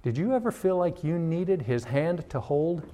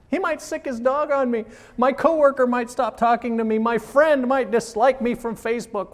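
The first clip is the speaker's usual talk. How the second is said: rising high and anxious, then louder; whiny scared voice climbing higher; imitating a nervous churchgoer; mock fearful; 8 semitones higher, 3 dB louder than usual. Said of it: "you're intimidated and you're like".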